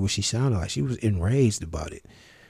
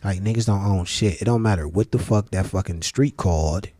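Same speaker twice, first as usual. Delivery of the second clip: ordinary delivery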